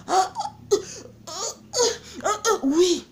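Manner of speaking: high, breathy mock moans; mock moaning. Woman imitating a moaning man in bed. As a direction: moan like a man in bed, hammed up. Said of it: "ils gémissent".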